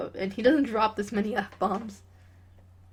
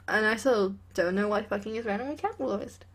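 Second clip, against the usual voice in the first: usual speaking voice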